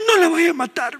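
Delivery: very high falsetto mimicking a granddaughter; granddaughter imitation. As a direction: plead in a put-on little granddaughter voice. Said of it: "Y mi nieta me dice".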